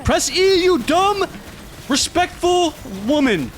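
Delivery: half-joking rage at the character; high comic frustration, slowing at the end; mock-angry yell. Real, not acted. Yelling at character mid-run.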